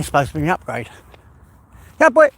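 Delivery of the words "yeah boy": calling out to dog. Real, not acted. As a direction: holler to your dog.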